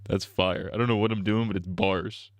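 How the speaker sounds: ordinary delivery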